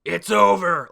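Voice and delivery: a hint of a gloating villain, loud at the end; lightly voiced villain declaring victory; mild villain gloat; villain boast. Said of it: "Dante's like".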